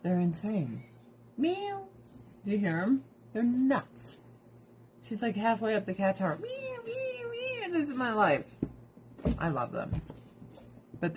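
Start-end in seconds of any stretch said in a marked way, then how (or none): 1.4-1.9 high, small mock meow; high-pitched kitty cry; imitated cat meow. Imitates her cats meowing. 6.4-7.7 soft, very high mock meowing; tiny high-pitched meows; imitated cat meows.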